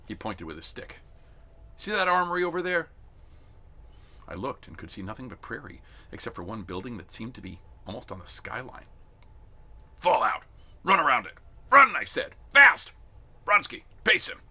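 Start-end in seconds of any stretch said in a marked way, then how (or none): 1.8-2.9 loud, high drill-sergeant call; barking sergeant. A narrator's reading. Sergeant shouts an order at the shivering recruits, loud. 10.0-14.4 furious high drill-sergeant yells; shouted orders. A narrator's reading. Drill sergeant yells at a recruit to run, furious shout.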